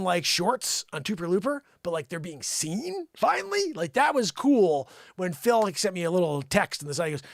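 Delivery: ordinary delivery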